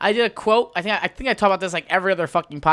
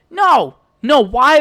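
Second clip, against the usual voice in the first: high, loud protest; indignant retold objection; exasperated refusal; 7 semitones higher, 7 dB louder, much slower than usual. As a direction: refuse loudly, voice jumping up. Rejecting the quote, exasperated.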